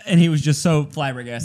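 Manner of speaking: ordinary talk, nothing marked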